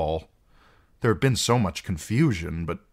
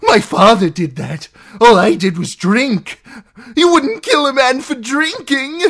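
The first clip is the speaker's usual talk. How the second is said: high, loud plea, climbing higher; desperate excuse; 12 dB louder than usual. Acted. Captive pleading innocence to outlaws, desperate. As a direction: shift the blame, begging.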